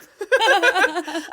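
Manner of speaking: bursting into loud laughter; big real laugh. Real, not acted. Laughing at own story.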